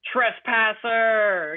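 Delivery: slow drawn-out mock skeleton yell; skeleton screaming. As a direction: act out an angry skeleton guard, slow and stretched. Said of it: "he was screaming".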